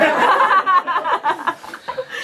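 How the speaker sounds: high, long laugh. Real, not acted. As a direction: crack up at the punchline. Laughing at own punchline.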